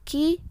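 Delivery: second "Q" said as rounded "kü"; French letter Q. Shown as a demonstration. Teacher showing how Q is read, French letter sound. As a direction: say "Q" as "kü" with pursed lips. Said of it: "huruf Q dibacanya".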